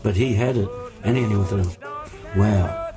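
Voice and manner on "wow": ordinary delivery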